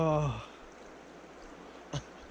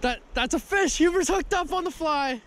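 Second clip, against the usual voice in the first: excited; 11 semitones higher than usual. Real, not acted. Fish hooked, calling out excitedly.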